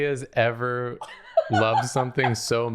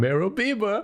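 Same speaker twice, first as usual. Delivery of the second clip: catchphrase voice; 11 semitones higher than usual. Pretend. Catchphrase said in a put-on voice.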